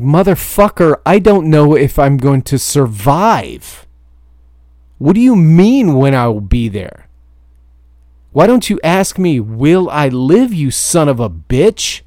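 high, loud enraged yell at the broker; furious screaming. Retold or imitated. Trucker screaming at his broker over the phone, furious yell. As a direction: scream it like the storm might kill you.

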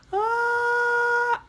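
very high, long acted scream; scared scream. Nora screams as she turns to stone, scared high scream. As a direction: scream out in fright. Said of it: "her eyes looked so scared".

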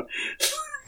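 high, breathy imitated shriek; tiny squeaky scream; mock scream. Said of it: "including the scream".

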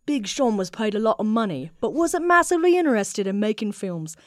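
put-on English accent, lightly done; British accent. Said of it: "I only have one British accent".

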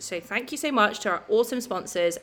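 upbeat sponsor read with discount details. Acted. Co-host giving listeners the discount code: upbeat ad read. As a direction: deliver the code and offer clearly and brightly.

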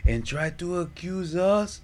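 slow, high, drawn-out preaching; mock preacher. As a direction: preach it slowly like a televangelist.

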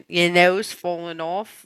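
lightly attempted Aussie accent; drawn-out, half-hearted Australian. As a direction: try an Australian accent, slowly. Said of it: "that was Australian".

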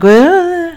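high, loud comic freak-out noise; high-pitched fussing whine; frantic mock wail. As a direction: wail it high, imitating your own panic. Imitates herself fussing over the house.

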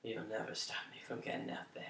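low hissed whisper. Acted. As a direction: whisper it like a vow. Whispered vow.

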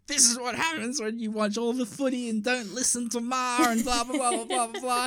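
high-pitched, nagging rant at dad; shitty mock rant. Son laying into dad about the footy, mock-angry rant. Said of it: "getting really shitty at him".

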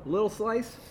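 normal talking voice

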